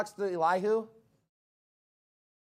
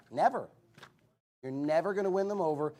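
ordinary delivery

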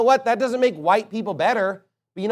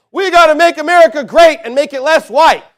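higher, louder mocking shout of false prophets; 7 semitones higher, 7 dB louder than usual. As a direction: yell their slogan back in scorn. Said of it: "and saying".

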